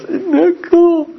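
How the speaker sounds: put-on loud, very high, slow baby voice; imitated baby. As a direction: squeak it out like a delighted baby. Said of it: "the baby goes".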